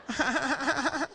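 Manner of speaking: imitated rapid staccato chuckle. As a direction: fake a friendly ha-ha laugh. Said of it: "friendly, high-pitched laugh for a grown man".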